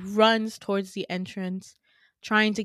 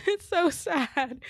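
laughing; 8 semitones higher, slower than usual. Real, not acted. Laughs while telling sad part.

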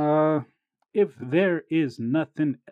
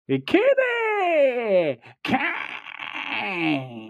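Vince McMahon voice; 10 semitones higher, much slower than usual. Acted. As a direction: belt the name like a wrestling boss. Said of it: "that sounded like some Vince-ism".

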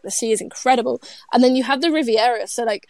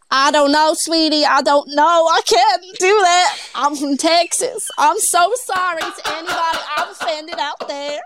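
Texan accent; 3 semitones higher, 5 dB louder than usual. Shown as a demonstration. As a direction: lay on a big Texan accent. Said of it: "Can you do Texan?".

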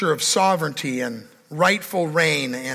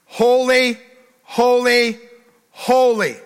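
"Holy" cried out higher and louder each time, with awe; proclaimed holy; 4 semitones higher, 4 dB louder, slower than usual. Shown as a demonstration. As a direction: lift each "Holy" high and full, like the heavenly cry. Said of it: "No, no, no, not like that".

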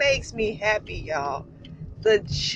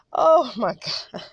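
laughing apology; 7 semitones higher than usual. Real, not acted. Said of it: "I'm so sorry".

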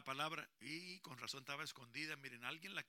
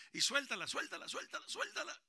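higher loud coaching; loud urging; 8 semitones higher, 8 dB louder than usual. Real, not acted. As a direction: cheer him to let it go. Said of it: "Lo más recio que puedas". (ánimo fuerte: suéltala)

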